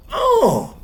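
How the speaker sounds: high, loud cry of pain; gasping in sudden stomach pain. Acted. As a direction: cry out, doubled over with burning pain. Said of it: "he cries".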